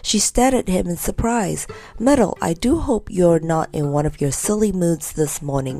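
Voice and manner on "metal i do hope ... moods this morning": ordinary delivery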